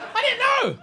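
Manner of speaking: very high wailed squeal; high, hammed-up yelp; helpless protest. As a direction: squeal your innocence. Protests at being mocked.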